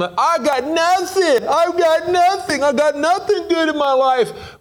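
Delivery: high, slow, whining complaint; whiny self-pity. Retold or imitated. Preacher mocking a self-pitying believer, high whine. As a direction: moan it like nothing is going right. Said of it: "we start thinking".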